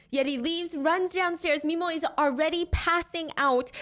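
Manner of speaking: normal talking voice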